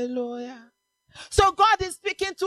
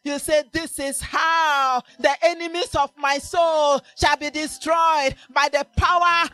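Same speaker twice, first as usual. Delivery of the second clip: loud, shouted sermon over music; full-force preaching; 5 dB louder than usual. Acted. Preacher shouting to the congregation, full force.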